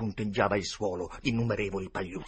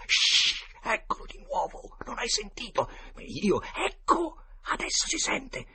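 high, half-whispered alarm after a sharp shush; urgent alarm; 4 dB louder, slower than usual. Acted. Boy hearing a noise among the graves, urgently alarmed. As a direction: strain to keep a frightened outburst hushed.

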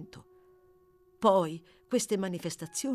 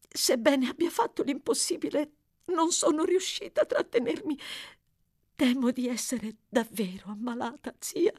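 much higher, choked tearful voice; tearful girl; 8 semitones higher than usual. A narrator's reading. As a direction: hold back tears and fail. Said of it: "alle lacrime che non riusciva più a trattenere". (voce acuta e rotta dal pianto)

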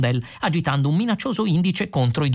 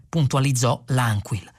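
ordinary delivery

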